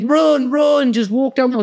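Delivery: hammed-up coach calling from the touchline; high mock coach shout. Youth worker urging the fat kid down the pitch, high mock shout. Coach yelling during rugby game.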